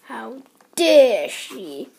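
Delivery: loud, drawn-out outrage; indignant outburst. A narrator's reading. Missus Gregg affronted by the duck, indignant outburst. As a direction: stretch it out in affront.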